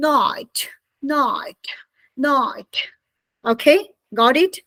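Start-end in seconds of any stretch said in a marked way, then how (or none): 0.1-2.7 British night with a crisp t; British pronunciation of night; British accent. Shown as a demonstration. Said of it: "And British people say".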